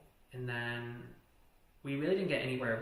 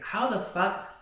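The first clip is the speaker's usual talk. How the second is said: high, louder, slow disbelief; retold how-dare-you incredulity, pitched up; incredulous outburst; 7 semitones higher, 6 dB louder, slower than usual. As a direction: stretch it out in exasperated disbelief. Acted incredulous outburst at partner.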